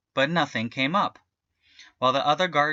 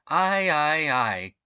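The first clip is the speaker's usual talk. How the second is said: a mock strained "ay, ay, ay"; crying out; 3 semitones higher, much slower than usual. A narrator's reading. Farmer straining at the stuck jalapeno, crying out mildly. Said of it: "cried Farmer Paco".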